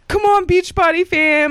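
high, drawn-out attention-seeking cheer; imitating a needy hype call; mock desperate screaming. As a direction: call out like an over-eager workout extra. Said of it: "desperately screaming".

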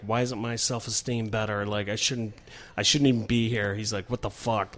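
mild mopey Bill. Retold or imitated.

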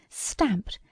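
plain reading voice

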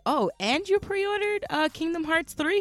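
high, impressed clerk voice; mock clerk voice. Mimicking the GameStop clerk, high and eager. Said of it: "and he's like".

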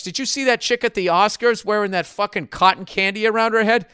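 usual speaking voice

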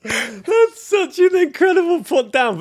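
laughing hard, high and loud; real laughter. Real, not acted. Host reacting to guest's jibe about his accent, laughing. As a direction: squeeze the words out through real laughter.

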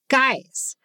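normal talking voice

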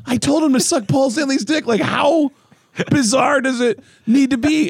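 can't believe it had to be spelled out; incredulous outburst. Retold or imitated. Recounting the prank call to his buddy, in disbelief. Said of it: "And I go".

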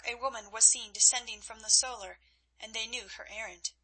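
ordinary delivery, nothing marked

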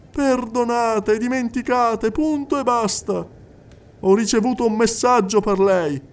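high, insistent clairvoyant addressing the hall; a medium coaxing a silent widow; medium's voice. Acted. Medium speaking to the crowded séance hall, high and insistent. Medium relays spirit message.

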